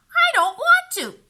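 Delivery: put-on high, loud whiny child voice; a stubborn little sister refusing; whiny little girl. A narrator's reading. Beatrice voiced by narrator.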